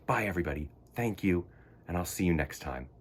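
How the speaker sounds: ordinary delivery, nothing marked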